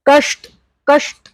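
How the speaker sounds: कष्ट with ष curled back as a retroflex sh. Shown as a demonstration. Teacher showing the retroflex ष in कष्ट. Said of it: "is retroflex".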